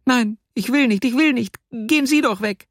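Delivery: put-on very high, pleading child voice; squeaky, insistent girlish refusal; distressed little girl. A narrator's reading. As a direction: protest as an upset small girl. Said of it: "sagte das Mädchen".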